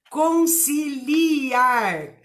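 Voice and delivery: Conciliar slowly and loud, syllable by syllable, con-ci-li-ar. Shown as a demonstration. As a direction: drag out each syllable of Conciliar. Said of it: "L I e não L H". (bem devagar, con-ci-li-ar)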